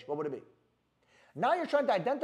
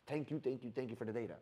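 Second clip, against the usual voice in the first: quiet mock thanks; 7 semitones lower, 10 dB quieter than usual. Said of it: "I'm like".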